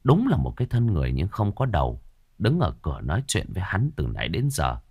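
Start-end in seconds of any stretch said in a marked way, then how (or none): none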